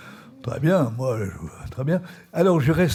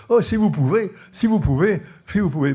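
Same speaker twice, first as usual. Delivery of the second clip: eager plea; 5 semitones higher, 4 dB louder than usual. Retold or imitated. Colonel pleads to be delivered.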